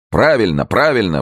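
high, exclaimed agreement; approving cry. A narrator's reading. All the guests backing the escape plan, approving cry. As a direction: cheer the plan on, understated. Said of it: "вскричали все остальные".

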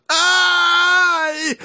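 long, high-pitched cry of dismay; alarmed scream. A narrator's reading. Dick sees Joe's horse go down, alarmed scream. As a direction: let out a drawn-out, piercing "ai". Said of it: "закричал Дик".

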